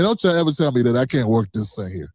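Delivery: usual speaking voice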